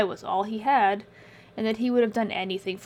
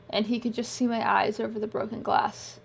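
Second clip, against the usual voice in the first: usual speaking voice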